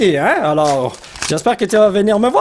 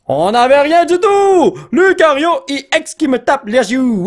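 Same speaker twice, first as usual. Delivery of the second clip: high, drawn-out excited shout; excited call; 7 semitones higher than usual. Real, not acted. Card opener announcing a hit to viewers, excited call. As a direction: call out the pull at a high pitch.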